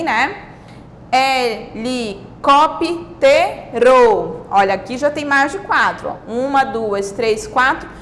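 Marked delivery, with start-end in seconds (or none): 1.1-4.4 HELICÓPTERO very slowly as he, li, cóp, te, ro; syllable by syllable. Shown as a demonstration. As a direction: break HELICÓPTERO into five separate beats. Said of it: "cinco palavras".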